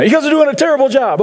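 high mocking whine of a crowd; squeaky put-on voice griping at leaders; mocking complainer. A mock whiny griper complaining at the leaders.

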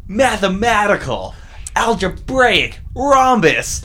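high, loud, drawn-out catchphrases; cartoon exclamations. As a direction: proclaim each word like a cartoon hero. Quoting pilot episode exclamations.

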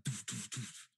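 punchy doof-doof beat noise; imitated music thump. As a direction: mouth a thudding bass beat.

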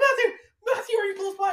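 high pleading shout for Matthew; frantic plea for help; desperate call. Retold or imitated. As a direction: call out for rescue. Calling for help again.

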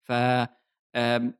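usual speaking voice

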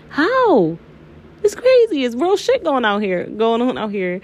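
high-pitched astonished outburst; amazed, pitch leaping way up; incredulous amazement. Real, not acted. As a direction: blurt it out in high disbelief. Amazed at the reader's accuracy.

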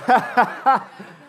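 loud, high burst of laughter. Real, not acted. Preacher amused by his volunteer, loud high laugh.

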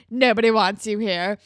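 loud hostile jab; threatening. Retold or imitated. Emma threatening Rick at the Dot, loud and hostile. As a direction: get in his face and shove the words at him. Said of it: "is very threatening and in his face".

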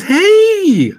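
high, loud sudden yelp; startled yelp. Acted. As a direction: yelp as your sleeve catches fire. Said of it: "And he says".

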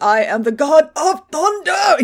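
slow, high, strained mock-heroic proclamation; straining Thor. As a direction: declare it grandly while straining against restraints. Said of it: "He's very restrained".

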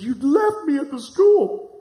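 high, drawn-out wifely complaint; imitated accusation. Wife phones husband who drove off without her, imitated accusing tone. Wife's upset accusation, acted in story.

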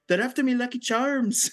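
high, hammed-up Irish leprechaun; cheesy joke Irish accent; mock Irish accent. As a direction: pipe up in fake Irish. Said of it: "make a joke in an Irish accent".

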